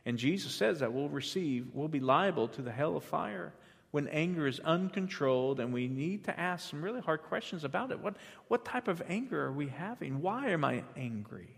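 ordinary delivery

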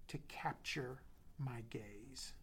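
breathy whisper, slow and hushed; a slow intimate whispered plea; whispered prayer. Acted. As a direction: breathe the prayer slowly, barely voiced.

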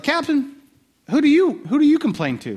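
high whiny complaint to the captain; whining soldiers. Retold or imitated. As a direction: whine it up high, like a grumbling soldier. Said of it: "they're whining to the captain".

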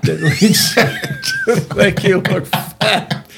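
drawn-out words breaking into laughter; laughing. Real, not acted. Speaker blurting out the tactless answer to the group, laughing. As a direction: crack up while getting the line out.

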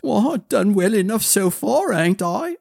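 high-pitched, plucky youngster; protesting child. A narrator's reading. Child arguing to go along with prince, protesting. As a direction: argue your worth in a child's voice.

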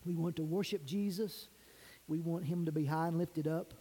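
low, subdued praying voice; public prayer. Real, not acted. Pastor leads church in prayer before preaching, low and earnest. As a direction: pray gently on their behalf, pitched low.

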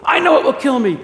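high, loud, anguished cry; acted ghost fearing death; fearful outcry. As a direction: cry it out in dread. Ghost cries out in fear.

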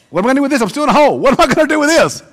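high, loud exasperated complaint. Acted. Stuck man complaining about the prayer, exasperated high. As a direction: throw the question back in disbelief.

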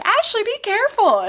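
high, mock-worried warning; worried twin. Mary Kate warning Ashley on the ledge, mock worried. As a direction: call out to her sister in a squeaky alarm.

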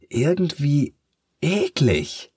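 put-on high, awed boy's voice; amazed. A narrator's reading. Boy marvels at the twisted new boy, high and awed. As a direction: marvel at something gross. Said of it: "sagt Matt staunend".

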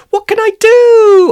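loud, high-pitched cry of panic; panicked. Acted. Arthur asks Romalie for help, panicked. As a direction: wail it out in storybook alarm. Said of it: "Arthur asked Romali in a panic".

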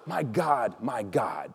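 hushed, falling plea; very soft, dropping low; deep feeling. Acted. Dying Jesus crying out to his Father, hushed and anguished. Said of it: "say it with deep feeling".

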